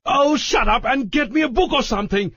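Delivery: high, exasperated yelling; furious Larry. A narrator's reading. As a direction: yell at everyone to shut up.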